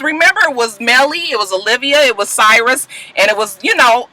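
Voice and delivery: ordinary talk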